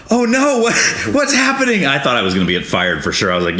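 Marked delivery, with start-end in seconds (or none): 0.1-1.9 high, panicked yelp of alarm; panicked surprise. Retold or imitated. New driver panicking as train derails, high alarmed cry. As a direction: squeal it out like the train is falling apart. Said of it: "I'm going".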